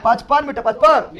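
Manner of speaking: ordinary talk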